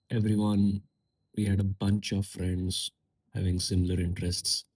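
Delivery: normal talking voice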